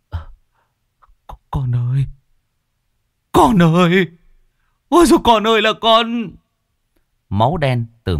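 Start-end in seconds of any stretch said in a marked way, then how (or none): 0.1-6.3 high, loud grief-stricken wail; anguished scream. Acted. Father hugging his drowned son's body, screaming in grief. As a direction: cry out for a dead child, climbing louder and higher. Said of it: "hét lên một tiếng".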